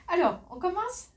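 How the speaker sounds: bright rising opener, high and loud; cheerful call to start, rising at the end; upbeat launch. Real, not acted. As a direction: kick the haul off with a lift. Energetic opening of the haul.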